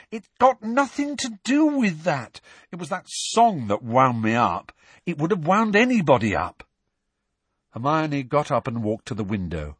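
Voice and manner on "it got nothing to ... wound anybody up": high, agitated protest; exasperated, insistent outburst; heated argument. A narrator's reading. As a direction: argue back hotly, pitch raised. Heated argument between the boys.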